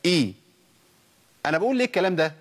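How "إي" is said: إي begun on a glottal stop with an "i" vowel; hamza with kasra. Shown as a demonstration. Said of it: "الهمزة المتحركة بكسر؟".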